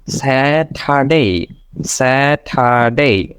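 Saturday very slowly as 'saa-tha-day'; slow syllable-by-syllable. Shown as a demonstration. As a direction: stretch each syllable of Saturday apart. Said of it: "first". (ধীরে ভেঙে ভেঙে 'স্যা-ঠা-ডে')

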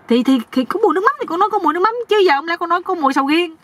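high, stammering, fast-starting protest; protesting nephew. Acted. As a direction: argue back in a high, exasperated voice. Nephew protests when mocked. (giọng cao, phân bua)

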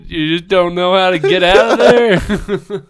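high, loud, laughing ribbing; laughing tease. Real, not acted. Teasing the friend, laughing.

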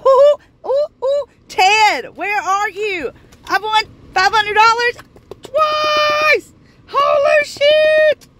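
high, loud, thrilled calls; excited shouting. Real, not acted. Calling out to Ted about the win, thrilled shouting. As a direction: yell the news across the house.

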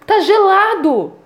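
a touch of awed surprise, drawn out; amazed mother. Retold or imitated. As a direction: mildly imitate mom's wonder at the wet, cold leaf. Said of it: "Minha mãe ficou maravilhada".

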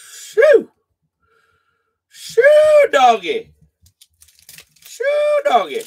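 sharp shoo at a dog; shooing an animal. Real, not acted. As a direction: shoo the dog away, high and loud.

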